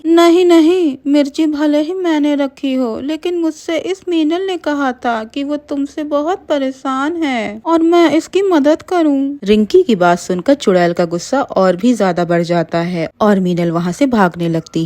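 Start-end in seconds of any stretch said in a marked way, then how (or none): 0.1-9.4 high, pleading voice; begging. Acted. Rinki begging the witch, high and pleading. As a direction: grovel for your life. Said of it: "Rinki गिड़गिड़ाने लगी".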